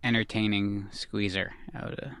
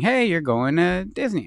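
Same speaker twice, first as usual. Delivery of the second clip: higher, louder mock surprise reveal; retold excited announcement; 6 semitones higher, 7 dB louder than usual. Parent revealing a holiday trip to the children, excited announcement. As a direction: announce it like a big treat for the kids.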